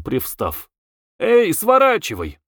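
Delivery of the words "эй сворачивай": shouted call. A narrator's reading. Young coachman to the cart ahead, shouting. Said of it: "крикнул".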